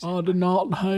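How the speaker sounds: Bill Clinton. Shown as a demonstration. President denying it, impersonated voice for laughing friends. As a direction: do the president making a famous denial. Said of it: "he does a great Bill Clinton".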